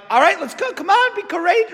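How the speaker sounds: high, eager mock cheering-on; crowd's encouragement. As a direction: urge him on like a sudden best friend. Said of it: "when then Jesus calls them, they're like".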